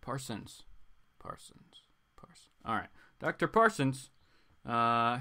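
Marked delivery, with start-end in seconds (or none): 0.0-2.5 fading repetition. Shown as a demonstration.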